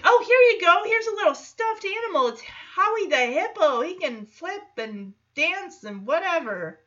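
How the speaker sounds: put-on high sing-song coaxing a toddler; cooing high baby talk. Teen offering a hippo toy to a kid, high coaxing voice. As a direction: sell the stuffed toy cutely. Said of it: "It's like".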